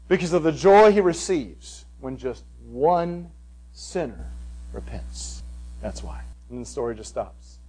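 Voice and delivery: dropping to a near whisper; hushed. Real, not acted. Preacher hushing at the story's climax. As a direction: let it fade to a hush. Softening to a hush.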